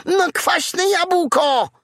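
very high, slow furious squeal; furious piglet. A narrator's reading. Piglet furious at the doubting fox, high angry squeal. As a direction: spit it out in a rage. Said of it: "Prosiaczek był taki wściekły".

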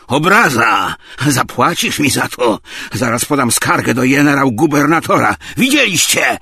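loud, high, offended shouting; outraged. A narrator's reading. Tarantiew threatens a complaint after the slap, outraged. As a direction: build to a high, loud demand.

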